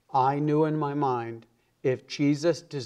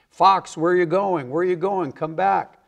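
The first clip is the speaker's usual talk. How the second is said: raised, mocking call after someone leaving; friends calling out; 5 semitones higher, 5 dB louder than usual. Party friends calling Fox back in a raised mock shout. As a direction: shout him back, a bit higher and louder.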